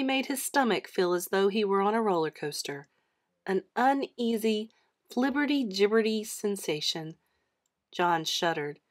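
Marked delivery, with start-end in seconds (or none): none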